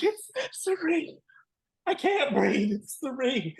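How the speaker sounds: very high, breathless fangirl squeal; fan meltdown. Retold or imitated. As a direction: squeal like the idol just appeared. Fan meltdown at seeing idol.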